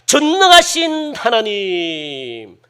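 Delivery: very drawn-out, fading invocation; mock solemn prayer, stretching the syllables and sinking; wrong way of praying. Shown as a demonstration. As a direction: intone it slowly, trailing down. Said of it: "이런 소리가 아니고".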